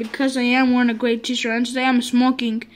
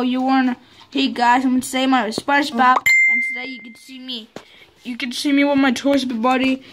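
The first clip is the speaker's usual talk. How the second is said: ordinary talk, nothing marked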